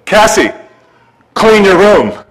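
imitating a no-nonsense dad; dad's command. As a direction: order it like a dad who means it. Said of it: "I had to rephrase it".

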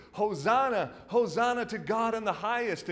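high, loud crowd cry of Hosanna; crowd's loud hosanna. Retold or imitated. Crowds hailing Jesus into Jerusalem, loud joyful cry. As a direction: ring it out like a jubilant crowd. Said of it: "the swarms of people are singing".

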